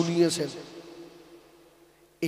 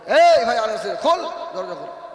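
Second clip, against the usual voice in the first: loud, higher shout to open up; urgent calling out; 4 semitones higher, 12 dB louder than usual. Acted. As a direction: call someone urgently through a closed door. Acting urgent call at a door. (দরজায় দাঁড়িয়ে জোরে হাঁক)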